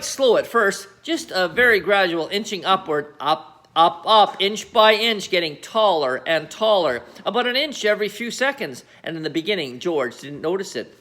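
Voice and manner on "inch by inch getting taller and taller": ordinary delivery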